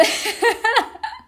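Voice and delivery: a short, high laugh; little laugh. Real, not acted. Laughing at her ex's relationship falling apart, a quick high giggle. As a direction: let the ego giggle at the ex's failed romance. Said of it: "my ego just had to have a little laugh".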